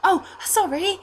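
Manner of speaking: put-on high, apologetic little boy; Reese. Altar boy asked his name, high flustered apology. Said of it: "twelve-year-old-ish looking blonde altar boy".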